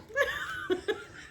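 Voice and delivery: a high burst of real giggling; high giggle. Real, not acted. Admitting she lied, a high giggle. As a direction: laugh at being caught out. Laughs at her own lie.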